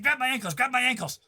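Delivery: high, urgent repeated demand; insistent gnome. Acted. Gnome urging player to grab his ankles, high and insistent.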